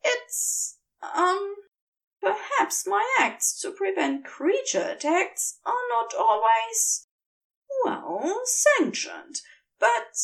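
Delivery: high, hesitant, halting angel voice; flustered angel. A narrator's reading. The blushing angel admits his deeds, high and halting. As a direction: stumble over the words, high and embarrassed.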